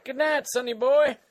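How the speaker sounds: put-on high, loud old-timer goodnight; cranky old man voice; Old Man Dan. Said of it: "Old Man Dan".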